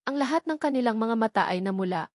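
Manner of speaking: plain reading voice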